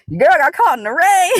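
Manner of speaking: high, loud cry breaking into real laughter; laughing holler. Real, not acted. Walker back at the car after rain, laughing holler. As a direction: holler it out and crack up.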